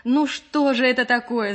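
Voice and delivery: high, loud and fed up; vexed. A narrator's reading. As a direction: burst out in annoyance. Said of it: "с досадой сказала Маша".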